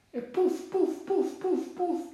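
high, rapid-fire puffs; playful storybook sound effect; plopping sound effect. A narrator's reading. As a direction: pop each puff out high, evenly spaced. Narrator imitates horse droppings landing.

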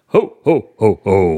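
hearty Santa laugh; big belly Santa chuckle; jolly ho ho ho. Pretend. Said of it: "am I Santa".

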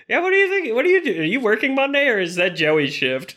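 imitated high-pitched nurse small talk; idle break chatter, starting very high; chatting nurses. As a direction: mimic nurses gossiping outside the door.

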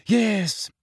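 high, sighing gloom; gloomy. A narrator's reading. Hermione confirming Lavender agrees, gloomy. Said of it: "she said gloomily".

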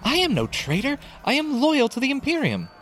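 very high, strained-pitch cry of loyalty; shouted protest. A narrator's reading. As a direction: protest at the top of your pitch. Centurion shouts protest while held.

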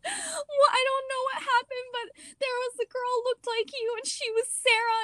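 imitating crying sister. Frightened little sister telling her big sister about Sarah, crying. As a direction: mimic a sobbing little girl, way up high. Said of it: "she just was like".

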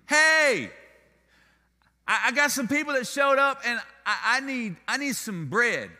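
loud, high, pleading call at the door; urgent plea. Retold or imitated. Man at neighbor's door at midnight, loud urgent request. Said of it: "and say".

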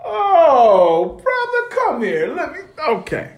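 hammed-up sob, pitch way up; mock-weepy mentor taking the student in; mock tearful. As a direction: pretend to be moved to tears. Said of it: "I'm looking at this brother like".

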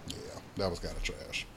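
soft whispered verdict; hushed, breathy throwaway; whispered aside. Real, not acted. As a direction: drop to a whisper to dismiss it. Dismissive aside after rant.

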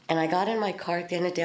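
ordinary delivery

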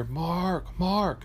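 wife calling out. Retold or imitated. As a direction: mimic a wife calling from another room.

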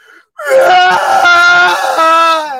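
high, loud burst of laughter. Real, not acted. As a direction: crack up out loud. Bursts out laughing mid-story.

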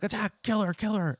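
high, shrill-free mock command to attack; mock villain order. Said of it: "she's like".

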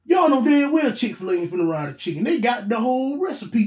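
ordinary delivery

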